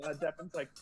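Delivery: usual speaking voice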